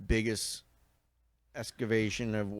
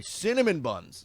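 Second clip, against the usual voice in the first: excited; 8 semitones higher, 4 dB louder, slower than usual. Real, not acted. Raving about breakfast foods.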